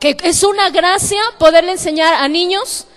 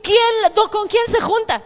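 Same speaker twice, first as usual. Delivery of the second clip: retold suspicion; 7 semitones higher than usual. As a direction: imitate the listener's suspicious surprise. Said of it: "me decía".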